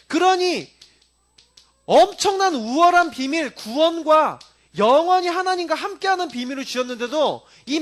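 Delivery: high, loud fervent sermon voice; full-force preaching. Acted. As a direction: drive the point home to the congregation.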